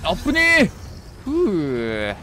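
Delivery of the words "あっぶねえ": much higher, louder frantic yelps; real panic, near miss; panicked. Real, not acted. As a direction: scramble out of danger in a panic. Narrowly escaping the monster.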